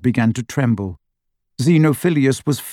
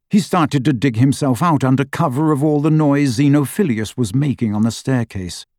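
ordinary delivery

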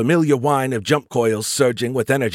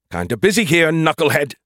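irritated, higher and louder brush-off; snapped; 6 semitones higher, 5 dB louder than usual. A narrator's reading. Skippy brushing off Joe while busy, snappish. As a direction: snap at him for interrupting. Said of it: "the beer can snapped".

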